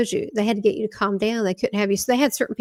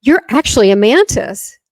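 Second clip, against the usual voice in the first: firmly announcing it, louder than her telling; retold stranger's emphatic claim; loud retold declaration; 10 dB louder than usual. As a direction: bold pronouncement. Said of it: "he goes".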